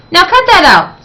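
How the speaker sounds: high, loud scolding; indignant yell. A narrator's reading. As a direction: cry out to make her stop. Principal scolding the fire-breathing librarian.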